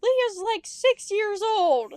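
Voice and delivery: exasperated. Retold or imitated. Kids protesting to angry dad.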